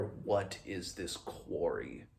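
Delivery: normal talking voice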